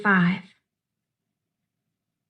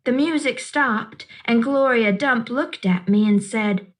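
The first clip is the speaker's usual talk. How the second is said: ordinary delivery, nothing marked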